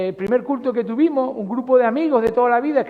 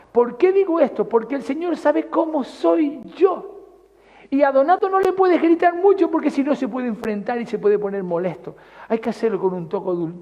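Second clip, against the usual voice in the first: usual speaking voice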